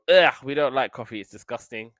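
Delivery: loud ugh, then grossed out; disgusted kids. Retold or imitated. Kids tasting hospital coffee, loud disgusted ugh. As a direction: spit out the taste like a kid.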